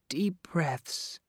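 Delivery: soft soothing whisper. Acted. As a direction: breathe it to calm him. Soothing the boy with asthma.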